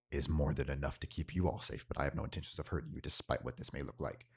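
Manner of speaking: low mysterious stranger. Acted. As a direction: reassure them while staying guarded. Voices hooded tabaxi figure.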